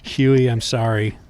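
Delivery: slow, low hammed-up apology; mock apology. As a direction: beg forgiveness with mock gravity.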